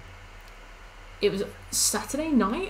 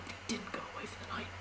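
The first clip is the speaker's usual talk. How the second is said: rushed conspiratorial whisper; fast whisper; 16 dB quieter, faster than usual. Real, not acted. Whispering a theory to chat. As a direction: hiss it out quick and quiet. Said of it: "whispered conspiracy aside".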